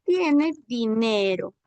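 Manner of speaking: "tiene dinero" said slowly; slow dictation. Shown as a demonstration. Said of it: "como queda en español". (dictado lento de "tiene dinero")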